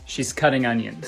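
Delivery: ordinary delivery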